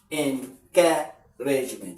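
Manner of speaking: Encouragement drawn out in separate syllables; half-speed "Encouragement" with breaks between syllables. Shown as a demonstration. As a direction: sound out "Encouragement" slowly, piece by piece. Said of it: "Three, four syllables now".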